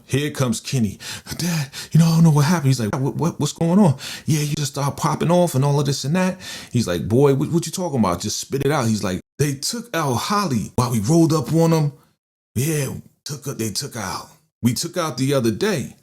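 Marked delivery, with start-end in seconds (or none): 1.4-2.7 high-pitched, distressed report; acted panic. Kenny running to dad with bad news, acted panic. 2.9-6.4 high, stammering, urgent imitation; acted alarm. Father asking frantic Kenny what's going on, acted alarm. 7.1-8.9 high, hurried, impatient imitation; urgent demand. Father pressing Kenny for the news, urgent demand. 9.4-15.9 high-pitched, upset imitation; acted distress. Kenny telling dad about Holly, acted distress.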